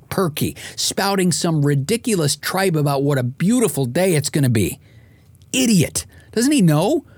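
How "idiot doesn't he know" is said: high, exasperated scoff at the radio; scornful. Pretend. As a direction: sneer it like the DJ is an idiot. Groggy listener scorns perky DJ.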